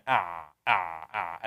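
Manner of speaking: أع squeezed low from the throat; throat sound ain. Shown as a demonstration. Teacher models عين from the throat. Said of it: "وسط الحلق".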